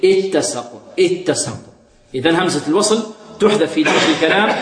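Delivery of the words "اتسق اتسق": "اتسق" begun on a clear "it"; starting with hamza. Shown as a demonstration. Teacher shows starting on the word, "اتسق" as ittasaq. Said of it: "بدأنا بها اختبارا هنا نقول".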